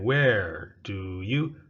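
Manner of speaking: "Where do you" stretched slowly, "you" full. Shown as a demonstration. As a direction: drag "Where do you" out for the class. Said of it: "Okay, repeat again".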